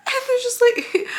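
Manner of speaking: high shrieking laugh into the words; laughing hard. Real, not acted. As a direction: crack up while talking.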